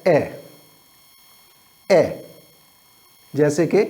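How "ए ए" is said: ए as a short, open "eh"; teacher demonstrating short ए; short A sound. Shown as a demonstration. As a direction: say ए short, like the vowel in van. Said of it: "ए की short sound है".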